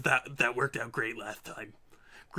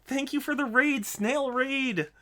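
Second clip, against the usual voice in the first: ordinary delivery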